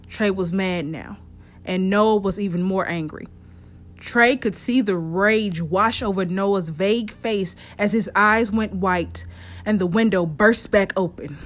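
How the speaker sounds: usual speaking voice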